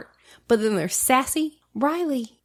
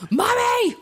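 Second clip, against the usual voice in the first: put-on accusatory child; 10 semitones higher, slower than usual. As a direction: blame mommy like a betrayed toddler. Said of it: "she was all accusatory".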